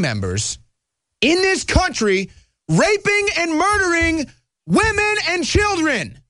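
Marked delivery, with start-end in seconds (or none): 1.2-6.2 rising indignation, each word pushed out high; fired up. Real, not acted. Host rails to viewers about gang crimes, fired-up high-pitched outrage. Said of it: "I get so fired up about this".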